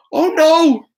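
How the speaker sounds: high, alarmed little cry; Toby sure the animals are back to eat her; a girl's sudden fright, pitched high; frightened gasp. Acted. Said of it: "thought Toby".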